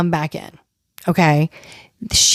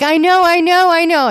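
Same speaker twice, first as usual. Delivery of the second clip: imitated higher, impatient "I know"; mock self-absorbed; 7 semitones higher, 3 dB louder than usual. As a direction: brush it off like a self-centred diva, pitched up. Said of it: "as narcissistic as she can be".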